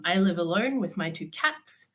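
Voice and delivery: ordinary delivery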